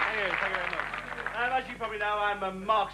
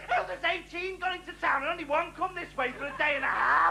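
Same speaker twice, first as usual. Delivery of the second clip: higher, droning bore voice; mock bore; 5 semitones higher than usual. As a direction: play the pompous idiot next door. Impersonating bores.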